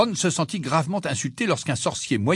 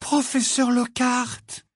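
high, slow, awed exclamation; breathless astonishment; 12 semitones higher, much slower than usual. A narrator's reading. Hermione spots Professor Lockhart, breathless with surprise. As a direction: draw out the name in amazed recognition. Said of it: "la voix haletante".

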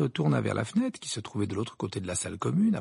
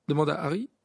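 ordinary delivery, nothing marked

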